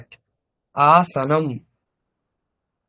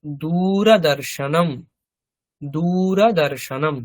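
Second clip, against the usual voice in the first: slow modeling; slower than usual. Shown as a demonstration. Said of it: "teacher models Sanskrit word".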